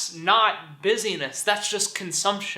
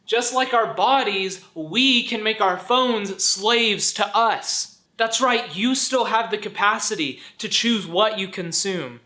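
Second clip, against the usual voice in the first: ordinary talk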